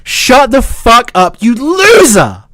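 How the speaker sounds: slow, drawn-out angry insult; real exasperated outburst. Real, not acted. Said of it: "I'm getting so angry".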